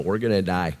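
ordinary talk, nothing marked

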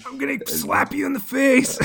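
high, loud play-acted threat; hammed-up brotherly rage; mock angry threat. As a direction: yell it like a furious sibling. Acting the brothers' bathroom fight.

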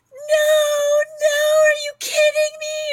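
high shrieking cry of panic; panicked high-pitched shriek; freaking out. Retold or imitated. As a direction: wail it out as if the world ended. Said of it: "she's, like, freaking out".

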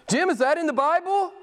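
a hint of a surprised questioner; quoting a churchgoer. Preacher recalling a Milan churchgoer's question, lightly voiced. Congregant quoted asking Jim.

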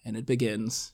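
softly creepy killer; a hushed, mildly eerie imitation; Kevin Spacey. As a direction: let it out quietly, all creepy. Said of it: "like, all creepy".

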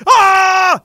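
high, loud mock death scream. Victim screaming as the cocaine bear eats him. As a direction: shriek like you're being mauled.

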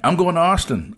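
eager reply, at talking volume; retold eager call. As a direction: act out hailing a stranger, pitch lifted. Retells answering a distant stranger.

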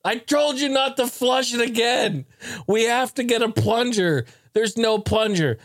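dad scolding. Retold or imitated. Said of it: "my dad was like".

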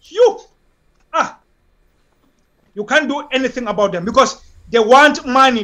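usual speaking voice

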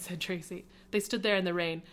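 ordinary delivery

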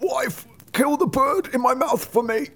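high, strained driver voice. A narrator's reading. Driver begging wife to strike, high urgent voice. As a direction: give the order in a high-pitched voice. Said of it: "The driver gave the axe to his wife and said".

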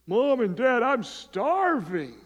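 high, loud whine with drawn-out words; hammed-up starving child, stretched and high; overdramatic starving kid. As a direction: exaggerate it like a child pleading with parents. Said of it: "They're just over dramatic and exaggerated".

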